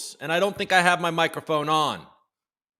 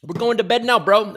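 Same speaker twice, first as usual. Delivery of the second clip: a bolder put-on British accent, a bit higher and louder; mock British, louder; 5 semitones higher, 5 dB louder than usual. Host retrying his British viewers' sign-off in mock British accent. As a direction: ham up the British accent on we're going to bed now bro. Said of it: "Is that how the, the Brits talk?".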